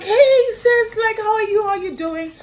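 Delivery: high and loud, bubbly hello; excited greeting. Retold or imitated. Picking up Naomi's call, a high, excited greeting. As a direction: gush at a friend who calls.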